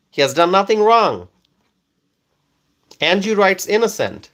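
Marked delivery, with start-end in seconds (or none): none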